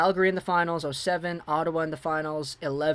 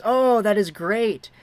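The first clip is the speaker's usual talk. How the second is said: excited; 5 semitones higher, 7 dB louder, much slower than usual. Real, not acted. Reacting to chat's cameo purchase.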